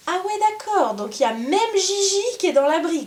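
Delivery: loud, high surprised exclamation. Real, not acted. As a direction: react with sudden loud surprise. Finds all horses in shelter.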